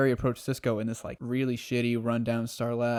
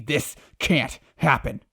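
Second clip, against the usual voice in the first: slow, louder, drawn-out denial; building anguish; 6 dB louder, much slower than usual. Retold or imitated. Savitar over the burned city, slow loud anguish. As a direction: drag out "this can't happen" in despair. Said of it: "He's like".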